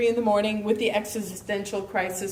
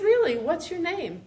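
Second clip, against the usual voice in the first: surprised; 7 semitones higher than usual. Retold or imitated. Surprised at meeting author.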